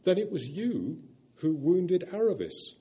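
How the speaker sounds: put-on a high, curious boy's question; puzzled boy. A narrator's reading. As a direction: ask it like a wondering child. Shasta asks.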